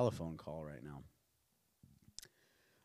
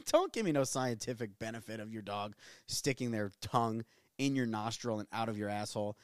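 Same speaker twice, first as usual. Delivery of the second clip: ordinary talk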